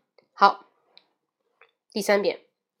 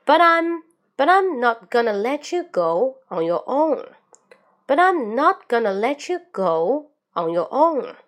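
slow linked phrases, BUT I'M raised, OWN swooping down; linked wave-like reading; 4 semitones higher, 4 dB louder, slower than usual. Shown as a demonstration. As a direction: link "but I'm" and "let you" with rolling intonation. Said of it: "注意连读". (中速偏慢连读，语调像波浪起伏)

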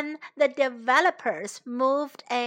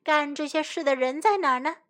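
usual speaking voice

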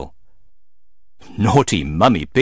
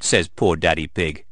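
usual speaking voice

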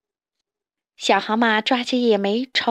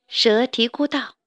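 usual speaking voice

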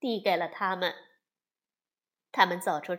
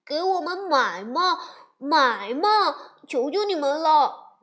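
higher, louder cubs pleading; whiny begging; 5 semitones higher, 8 dB louder than usual. Acted. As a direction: wheedle for the toy. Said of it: "孩子们却央求道". (孩子们撒娇央求爸妈买玩具)

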